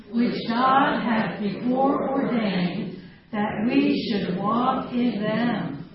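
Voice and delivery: slow, loud group recitation; reading aloud together. Real, not acted. Speaker leading the room in a Bible verse, reading aloud together. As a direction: recite the verse slowly and firmly, in unison. Why don't we read it all together.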